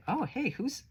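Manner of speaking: sudden high, bright surprise at the card; surprised delight. Real, not acted. Reader spotting a hidden figure on a card, surprised and delighted.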